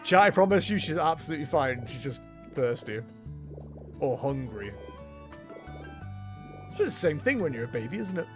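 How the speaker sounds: ordinary delivery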